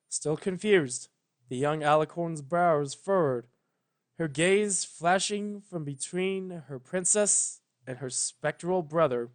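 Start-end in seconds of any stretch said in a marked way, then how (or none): none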